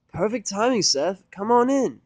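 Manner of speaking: high, loud, upbeat mare voice; Vinyl's voice. Acted. Vinyl greets Seth at door.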